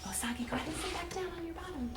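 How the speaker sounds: gentle sing-song bedtime read; reading to children. Acted. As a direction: lull them toward sleep. Reads bedtime book to children.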